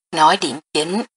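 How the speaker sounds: mildly impatient command; low-voiced rebuke. A narrator's reading. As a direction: cut in with a restrained scolding. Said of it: "hắn thấp giọng quát lên". (khẽ quát, mất kiên nhẫn)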